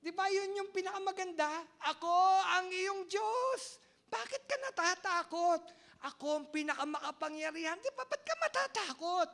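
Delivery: loud, high preaching cry; impassioned preaching. Acted. Pastor crying out to the congregation, impassioned. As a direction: proclaim it to the whole congregation.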